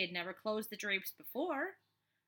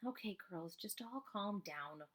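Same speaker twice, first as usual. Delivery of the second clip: calming teacher; 6 dB quieter than usual. Acted. Teacher settling two quarrelling girls.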